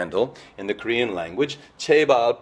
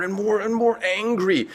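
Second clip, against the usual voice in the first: mock rising anger; 11 semitones higher than usual. Shown as a demonstration. Illustrating anger building up.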